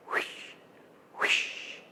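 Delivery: breathy fading swish sound; hushed airy whoosh; soft whispered whoosh. A narrator's reading.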